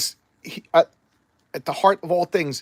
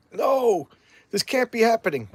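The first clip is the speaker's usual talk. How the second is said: higher, a lightly voiced cry of dismay; mock dismay; 7 semitones higher than usual. Spider-Man realizing he is trapped, a higher mock cry. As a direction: play it as a mild mock shock.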